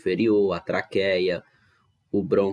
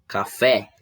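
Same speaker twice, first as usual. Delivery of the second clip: "café" with a louder, forceful "fé"; stress on FÉ; 4 dB louder than usual. Shown as a demonstration. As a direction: punch the last syllable of "café". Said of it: "foi pronunciada com mais força". ("café" com ênfase forte no "fé")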